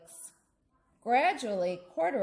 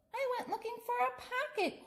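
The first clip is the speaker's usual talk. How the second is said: high, soft, sheepish little bear; small bear voice; 5 dB quieter, faster than usual. Acted. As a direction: answer meekly like a tiny bear. Bear Corduroy answers, voiced.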